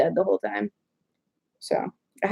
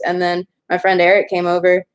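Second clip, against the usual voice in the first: normal talking voice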